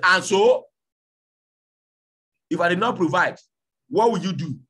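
loud, high, heated retort; angry retort. Acted. Husband yelling back at his wife, heated. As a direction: throw the question at her angrily.

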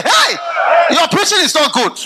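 high, loud mock call from the pews; mock heckling member. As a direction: call out the pastor like a fed-up member. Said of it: "They are not going to stand and say".

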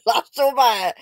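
normal talking voice

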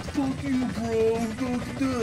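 high sneering imitation; high put-on dudebro sneer; mock insult. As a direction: mimic the kid yelling out the window. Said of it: "and just like".

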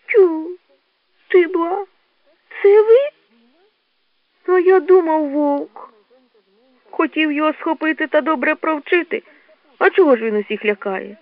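high childlike badger voice; embarrassed little badger. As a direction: pipe up in surprise, high and put-on. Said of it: "Барсучок геть зніяковів". (зніяковіле борсученя, високим голосом)

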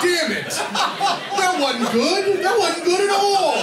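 high, laughing self-reproach; laughing regret. Retold or imitated. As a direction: crack up at your own mistake. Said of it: "And I was like".

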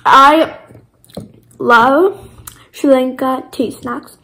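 ordinary talk, nothing marked

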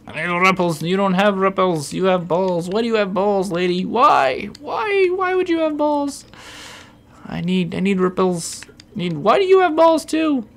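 comic outraged squeal; mock outraged rant. Real, not acted. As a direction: rant at the shopkeeper in a shrill put-on voice. Ranting at shop lady.

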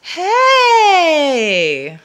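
sudden loud, high cry; a sudden alarmed cry; startled shout. Acted. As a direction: yell out in surprise. Bob shouts as Fluffy looms.

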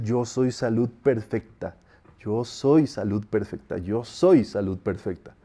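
"Yo soy salud perfecta" low, soft and slow, weight on "soy"; felt intonation. Shown as a demonstration. Teacher showing where the feeling goes, low, slow stress on "soy". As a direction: sink into "soy" with quiet feeling. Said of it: "la entonación es el, donde va el feeling".

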